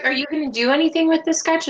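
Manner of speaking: usual speaking voice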